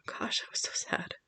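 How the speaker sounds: tearful whisper. Real, not acted. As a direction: barely voice the words. Tearful memory of sad news.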